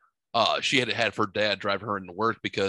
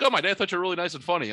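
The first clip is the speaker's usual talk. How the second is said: wife's voice; 8 semitones higher, faster than usual. Retold or imitated. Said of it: "She's like".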